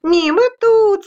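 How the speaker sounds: high, loud little voice; high-pitched merry dust specks; gleeful taunt. Acted. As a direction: call out mockingly from above. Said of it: "сміялися з карниза інші".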